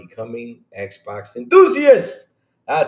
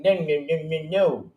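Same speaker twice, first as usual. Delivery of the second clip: higher, whiny mock protest rising to a louder peak; mocking fanboys; 8 semitones higher, 4 dB louder than usual. Streamer mocking PlayStation fans protesting the sign-in, whiny and rising. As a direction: parody a complaining fanboy, building into outrage. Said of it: "They're out there fighting this right now saying".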